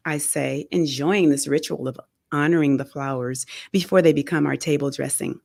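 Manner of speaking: ordinary delivery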